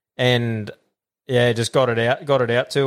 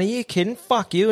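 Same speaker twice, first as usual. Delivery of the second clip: higher, indignant "are you kidding"; mock outrage; 10 semitones higher than usual. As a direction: retort in disbelief, pitched way up. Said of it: "I just went".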